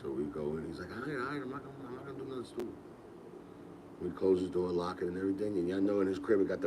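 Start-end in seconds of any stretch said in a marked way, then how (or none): 0.9-2.7 much softer, barely voiced reassurance; hushed promise. Tug hushing his friend in the dark house, softly promising. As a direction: keep it down so the parents don't wake. Sneaking in late, parents asleep.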